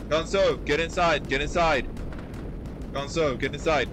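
loud, urgent call to get inside; urgent shout. Real, not acted. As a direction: yell the order over gunfire. Ordering gunner inside under fire.

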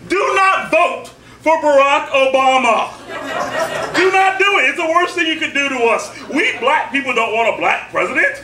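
very high, loud stage tirade; shouted satirical rant. Acted. Poet warning the crowd about voting, shouted comic rant. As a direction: holler it in mock alarm, stretching the key words.

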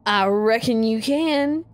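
slow Southern reckon; Southern drawl. Acted. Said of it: "Yeah, reckon".

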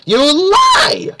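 high loud accusing yell; furious shout. Real, not acted. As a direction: scream the accusation.

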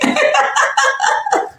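high-pitched giggling burst; real joking laugh. Real, not acted. Streamer laughing at her own joke, high giggle. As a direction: break up in a long high laugh. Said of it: "On en rigole".

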